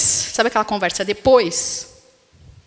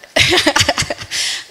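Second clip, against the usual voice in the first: breathy, unvoiced burst of laughter; airy laugh; 7 semitones higher, 3 dB louder than usual. Real, not acted. Wife laughing about the husband brushing her off, in an airy breathy laugh.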